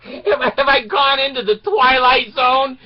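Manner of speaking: high, loud disbelief; incredulous outburst. Retold or imitated. Teen driver asking the attendant in disbelief, loud. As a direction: throw up your hands in disbelief.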